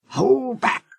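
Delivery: usual speaking voice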